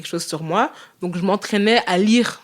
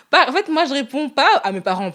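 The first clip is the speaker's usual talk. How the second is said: laughing; 7 semitones higher than usual. Real, not acted. Laughing as she corrects herself.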